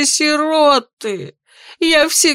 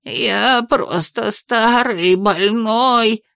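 trembling; much slower than usual. Acted. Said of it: "затрясся чиновник".

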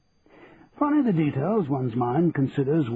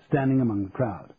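usual speaking voice

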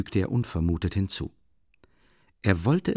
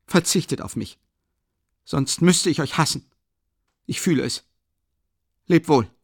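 lightly voiced tense young man, a bit higher; troubled son Rodja; 5 semitones higher, 3 dB louder than usual. A narrator's reading. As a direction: push through the farewell, growing insistent. Rodja's long farewell to family. (leicht gesprochener, angespannter Sohn beim Abschied)

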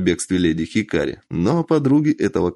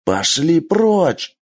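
angry shout; 8 semitones higher, much slower than usual. Acted. Бенджиро fights off samurai.

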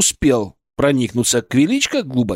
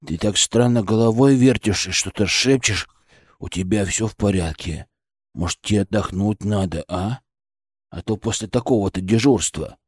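low, softer, hesitant colleague voice; concerned colleague; 7 semitones lower, 3 dB quieter than usual. Acted. Colleague asks whether his friend is all right, concerned. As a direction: tread carefully, voice dropped low and soft.

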